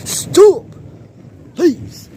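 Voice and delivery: high-pitched begging cry; loud, high plea; frightened plea. Real, not acted. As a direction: beg it to stop in a high yelp. Pleading with the storm to stop.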